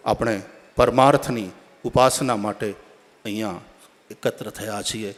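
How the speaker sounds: ordinary delivery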